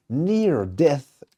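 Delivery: put-on slow, careful English accent on the title. Host announcing the next track in English accent. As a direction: savour the English title in your best English accent. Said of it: "Vous avez vu mon accent anglais".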